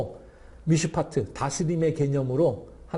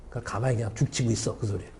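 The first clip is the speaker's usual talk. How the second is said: normal talking voice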